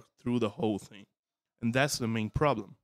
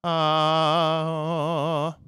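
spooky voice compared; 4 semitones higher, 5 dB louder than usual. Shown as a demonstration. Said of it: "So you go like".

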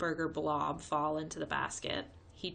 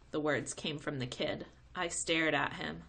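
ordinary delivery

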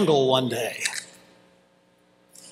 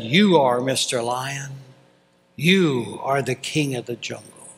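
ordinary talk, nothing marked

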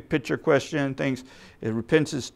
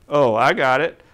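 ordinary talk

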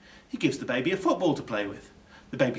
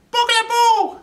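put-on very high, loud babyish squeal; baby repeating the burglar's name; 13 dB louder than usual. A narrator's reading. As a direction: say it as a babbling toddler. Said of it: "says the baby".

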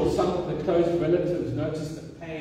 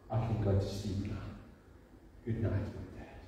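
whispered goodnight; 6 semitones lower, 9 dB quieter than usual. Retold or imitated. Father whispering goodnight to God.

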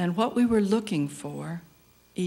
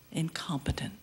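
whispered; 7 dB quieter than usual. Acted. Self-reproach whispered in poem.

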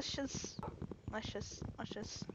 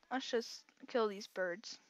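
ordinary talk

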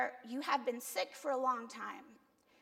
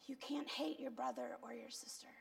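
whispered warning; 10 dB quieter than usual. Real, not acted. Preacher whispering the rule to the congregation, whispered warning. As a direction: drop to a whisper to drive it home.

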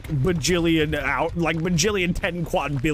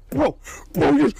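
much higher and louder, slow whiny mock; mocking whiny chatter; 11 semitones higher, 4 dB louder than usual. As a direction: mimic the chat's whining, drawn out. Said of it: "And it's like".

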